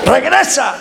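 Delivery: high, falling mock challenge; retold dare. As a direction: repeat the dare as a cocky boy. Acts himself daring the boy back. (reto de muchacho envalentonado, imitado)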